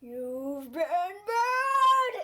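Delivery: very slow, wailing spooky verdict; demon phone voice. Retold or imitated. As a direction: stretch each word, rising into a wail.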